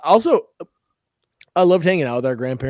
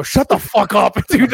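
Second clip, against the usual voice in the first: high, loud retold outburst cracking into laughter; hammed-up furious "shut up" at a friend; outraged yell; 7 semitones higher, 7 dB louder than usual.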